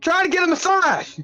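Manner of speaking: high-pitched comic yell; hammed-up indignant shout, pitch way up; yelling at the group. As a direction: holler the complaint across the room. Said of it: "I yell to the group".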